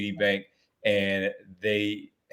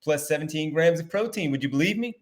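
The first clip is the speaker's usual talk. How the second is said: upbeat, higher-pitched sponsor read; 5 semitones higher than usual. Acted. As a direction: sell it like a podcast host reading a sponsor spot. Reads a sponsor ad.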